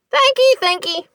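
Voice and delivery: gasped. A narrator's reading. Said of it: "Mary gasped".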